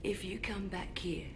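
cold, soft whisper; threatening whisper. Acted. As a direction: hiss the threat softly.

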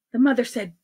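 plain reading voice

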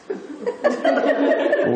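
a sudden high laugh at his own punchline; high breathy laugh. Real, not acted. Laughing at his own punchline to the crowd, high. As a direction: let the laugh burst out high.

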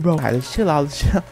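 normal talking voice

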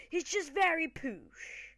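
high, drawn-out posh British voice; posh British accent. Pretend. Gamer explaining his old man character, in exaggerated posh British accent. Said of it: "He's a very posh old man".

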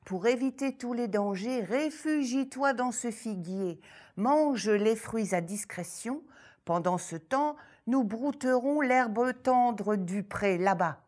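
put-on bright, loud hares advising the girl; hares speaking. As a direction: call out warmly to the little one.

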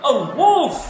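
high, loud alarm shout; acted cry of wolf. A narrator's reading. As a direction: shout a warning to the village. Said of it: "he shouted".

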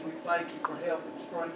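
ordinary talk

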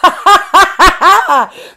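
loud, high demo laugh. Shown as a demonstration. Teacher showing kids a laugh, loud laughing. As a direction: laugh out loud to show funny. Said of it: "A laugh".

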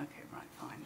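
very soft, half-whispered brush-off; retold humouring reply under the breath; muted acquiescence. Retold sceptical reply to the prophecy.

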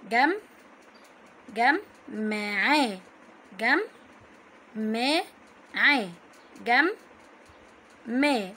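"جم، معاه" slowly split as gam, ma, 'a; doubled letter demonstration. Shown as a demonstration. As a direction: close "جم" on the m, pause, then open "ما". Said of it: "نطقناها مرتين".

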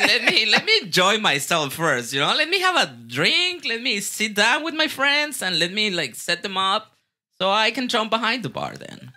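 animated, put-upon negotiating; retold protest. Retold pleading to delay bar work.

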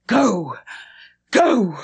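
loud, urgent command; almost frantic. A narrator's reading. Governess ordering Mrs. Grose to go, almost frantic. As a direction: send her off, frantic. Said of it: "imperative, almost frantic".

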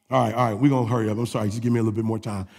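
ordinary delivery

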